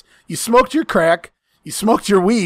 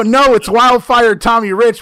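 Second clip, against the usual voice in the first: louder, higher mock protest; indignant outburst; 4 semitones higher, 5 dB louder than usual. Friend acting his unspoken reply to the dealer, loud mock indignation. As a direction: blurt it out loudly, as an imagined comeback.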